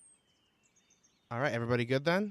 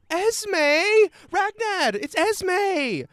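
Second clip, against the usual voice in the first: very high, loud delighted calling; wide-eyed excitement; 10 dB louder, much slower than usual. Acted. Druid woman recognizing Esme and calling Ragnad, excited shout. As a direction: shout the news to your companion. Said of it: "her eyes go wide and she says".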